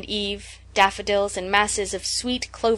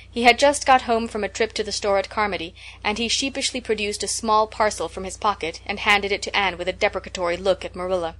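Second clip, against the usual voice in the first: usual speaking voice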